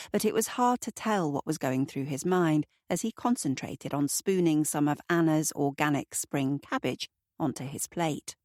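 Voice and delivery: usual speaking voice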